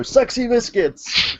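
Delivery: high, drawn-out hero call; acted catchphrase. Host acting out his morphing catchphrase, mock-heroic. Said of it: "I go".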